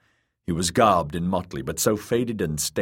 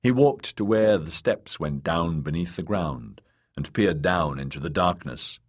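ordinary delivery, nothing marked

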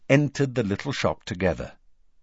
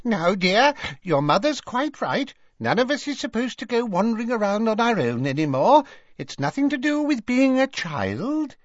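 high-pitched fussing shopkeeper; high, chiding shopkeeper voice; Madame Malkin. A narrator's reading. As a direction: soothe a customer in a high, put-on woman's voice. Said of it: "a voice Harry recognized as that of Madame Malkin".

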